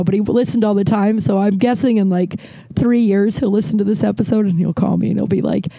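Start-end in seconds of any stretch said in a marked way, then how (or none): none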